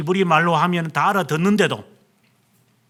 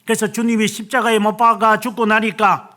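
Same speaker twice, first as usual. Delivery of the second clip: ordinary talk, nothing marked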